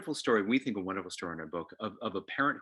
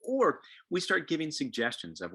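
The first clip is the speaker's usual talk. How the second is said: normal talking voice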